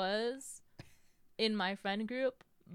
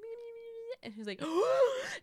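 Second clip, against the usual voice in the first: high imitated sobbing whimper; mock sob, high and drawn out; crying whimper; 14 semitones higher, 5 dB louder than usual. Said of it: "and I was like".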